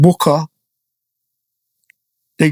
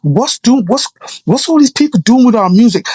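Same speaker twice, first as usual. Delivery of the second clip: losing it; 7 semitones higher than usual. Retold or imitated. Friend losing it over the radio news, raised and outraged. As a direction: vent in disbelief. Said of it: "he just lost it".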